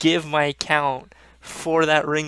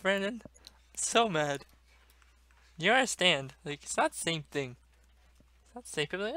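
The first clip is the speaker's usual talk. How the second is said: ordinary delivery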